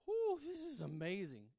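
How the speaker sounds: loud, slow, drawn-out delight; delighted amazement. Retold or imitated. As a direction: savour it loudly, as if overcome. Acts out his delighted reaction.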